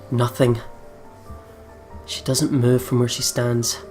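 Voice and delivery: ordinary delivery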